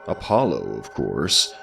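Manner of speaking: ordinary delivery, nothing marked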